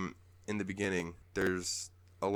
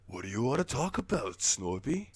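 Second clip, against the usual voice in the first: a hint of a character voice, slightly raised; lightly voiced Chandlo; 4 semitones higher than usual. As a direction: lightly play the game buddy asking. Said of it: "Chandlo's like".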